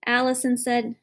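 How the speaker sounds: ordinary delivery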